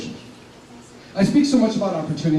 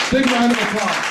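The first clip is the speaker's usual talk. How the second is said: loud and higher, rallying the room; calling for applause; 8 semitones higher, 5 dB louder than usual. Real, not acted. Urging the audience to applaud standing veterans, loud rallying call. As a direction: pump the crowd up for the veterans.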